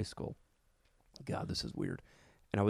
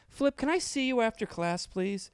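teacher voice; 13 semitones higher, 3 dB louder, slower than usual. Retold or imitated. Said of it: "Miss Crago goes".

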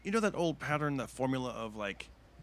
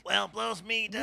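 slowed weak Popeye line; 10 semitones higher, much slower than usual. Retold or imitated. Limp Popeye line while booed.

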